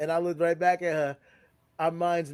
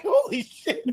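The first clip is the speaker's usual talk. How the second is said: very high, loud laughing exclamation; mock shock; 8 dB louder, slower than usual. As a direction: burst out in exaggerated astonishment. Retold shock at friend's missed burger.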